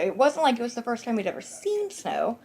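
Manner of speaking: usual speaking voice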